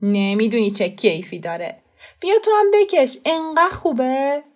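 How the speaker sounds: put-on coaxing child's voice, jumps high and loud; tempting boy. A narrator's reading. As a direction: lure his cousin to try it. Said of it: "خسرو چشمهایش را بست و گفت".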